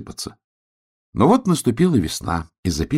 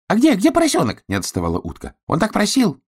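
little duck voice; 13 semitones higher, faster than usual. Acted.

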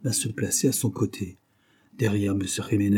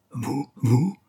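halting, shocked double take, pitched up; choked shock; 4 semitones higher than usual. A narrator's reading. Duke suddenly seeing the young woman, gasping shock. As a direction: stammer it out in shock. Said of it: "hoqueta le duc en apercevant la jeune femme".

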